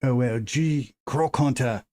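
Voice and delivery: a hint of a mock Australian accent, lightly put on; Steve Irwin Australian. Stream opener hamming up a lightly put-on Australian accent. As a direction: streamer greets viewers Croc Hunter style, in a light mock Australian accent. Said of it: "terrible, terrible accent".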